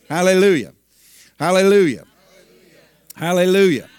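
loud repeated hallelujahs; loud praise. Real, not acted. Preacher leads the congregation in loud repeated praise. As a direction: ring out each hallelujah.

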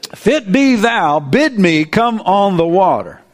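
"If it be thou" dwelt on slowly; slow, wondering re-read. Shown as a demonstration. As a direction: linger over "If it be thou" as if amazed. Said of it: "What on earth inspired Peter to say that?".

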